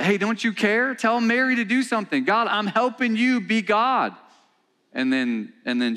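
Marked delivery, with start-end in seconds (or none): 0.0-4.2 high, pushy complaint voice, acted out, not quite a shout; a put-upon sister whining that God doesn't care; exasperated Martha. Preacher acts out Martha's complaint.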